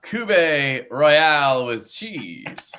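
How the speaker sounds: drawn-out "koo-BAY" for Cube; mock-grand slow "koo-BAY" on Cube; Stevie's pronunciation. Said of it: "he calls it".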